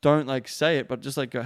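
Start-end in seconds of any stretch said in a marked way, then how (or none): none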